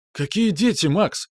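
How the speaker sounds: high, strained near-shout; nearly yelling in disbelief; almost shouting. Acted. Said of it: "едва не крича продолжал Луис". (почти кричит)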